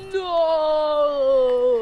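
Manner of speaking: loud, long drawn-out despairing no; acted loud, sustained cry of refusal; Charlie's mock cry. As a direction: stretch it into a hammed-up movie-style wail. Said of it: "and then Charlie was like".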